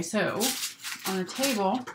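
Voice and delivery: ordinary talk, nothing marked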